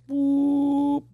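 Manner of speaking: high-pitched zooming whoop; a quick siren-like whoop. Retold or imitated. Wife speeding off to hospital.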